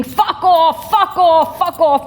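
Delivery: a bully yelling fuck off over and over; imitated screaming. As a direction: shout it like a bully at a meeting. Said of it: "one bully will be screaming".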